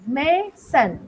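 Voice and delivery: Mason split as मे, pause, सन; teacher's syllable demo of mason; two syllable groups. Shown as a demonstration. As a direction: break mason into two separate beats. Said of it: "इसमें भी ये दो group words बन रहे हैं".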